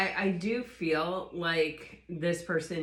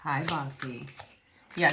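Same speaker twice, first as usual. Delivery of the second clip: ordinary talk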